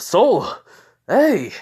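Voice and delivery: high, loud call of recognition; surprised greeting. Acted. Naofumi spotting his friend at the cart, surprised greeting. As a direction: hail a friend you just spotted.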